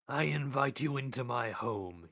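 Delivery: slow, cold rebuke; cold reproach. Acted. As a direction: start the rebuke slowly and heavily. Death rebukes Mort.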